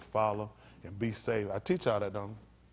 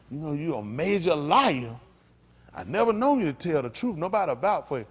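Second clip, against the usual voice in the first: higher-pitched accusing quote; acted accusation; 6 semitones higher, 5 dB louder than usual. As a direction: call out a liar in someone else's words. Acts out someone calling out liar.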